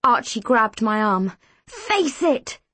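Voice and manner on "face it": high-pitched urgent command; restrained scream. A narrator's reading. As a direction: cry out an order, not at full volume. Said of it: "he screamed".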